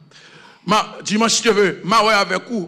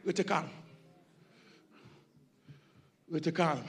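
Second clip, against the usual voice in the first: "Restez calme" breathed very softly and low; soft, low preacher's hush; 4 semitones lower, 16 dB quieter than usual. Acted. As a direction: sink to almost nothing, soothing. Preacher suddenly drops to hush.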